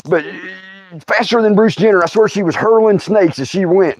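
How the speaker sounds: ordinary delivery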